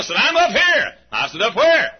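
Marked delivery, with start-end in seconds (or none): 0.2-0.9 high, loud holler from above; calling out. Retold or imitated. Man in a tree answers the doorbell, hollering. 1.4-1.9 loud, higher puzzled holler; calling out. Retold or imitated. Preacher looks for the man above him, calling out. Preacher calls back upward.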